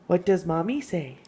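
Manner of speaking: usual speaking voice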